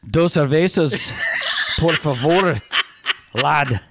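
mock Irish lad, pushing the brogue; playful fake Irish accent with Spanish bits. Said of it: "I'm gonna talk with a, an Irish brogue".

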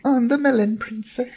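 very high princess put-on; hammed-up girly royalty; melon princess. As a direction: announce yourself in a squeaky-high princess voice.

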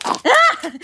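high sudden shriek; startled shriek. Acted. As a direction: yelp at the sight. Startled by dangly prosthetics.

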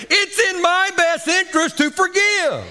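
high shouted proclamation; shouted climax. Real, not acted. Preacher shouting the lesson to the congregation at the climax. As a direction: shout it as the peak.

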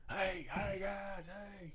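high, far-off mock coach holler in a Cajun drawl; hammed-up Coach O calling across the field; imitating Coach O yelling. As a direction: call the players over like the coach. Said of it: "just yelling halfway across the field in his, in his accent".

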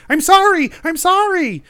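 high, loud wailing apology; anguished cry. Acted. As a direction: wail it out in distress. Distraught father apologising during a raid.

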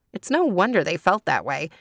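ordinary delivery, nothing marked

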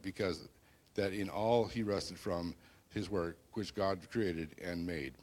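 booming biblical narration. Acted. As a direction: proclaim the verses from the pulpit. Reading Genesis chapter two.

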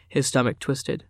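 ordinary delivery

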